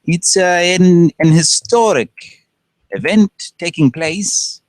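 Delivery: high, slow British presenter imitation; loud, drawn-out mock British announcer; British guy. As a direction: put on a stately, high British voice. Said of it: "it'd be some British guy".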